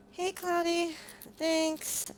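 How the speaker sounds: ordinary talk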